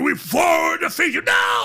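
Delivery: high, drawn-out ritual scream; full-voiced imitation of a booming ceremony. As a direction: bellow it slowly, building to a roar.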